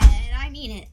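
suddenly loud and emphatic. Real, not acted. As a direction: declare it firmly, loudly. Son insists before tasting.

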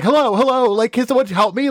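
half-hammed desperate call for help; frantic caller. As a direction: imitate a frantic husband begging for help. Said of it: "it was like".

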